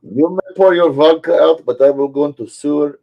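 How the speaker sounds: put-on Russian accent; mock Russian accent. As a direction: play a boastful cartoon Russian. Carries on Russian bit.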